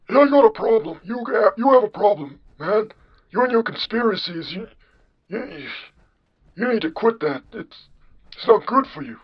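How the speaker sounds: put-on high-pitched comic old man; John Henry. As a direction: scold in an exaggerated old-man falsetto. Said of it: "you have the generic old man voice".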